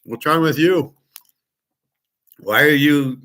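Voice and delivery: mental needling. Retold or imitated. Shopper getting at a stranger mentally, needling. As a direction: lift the pitch to get under his skin. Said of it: "I'll get him mentally".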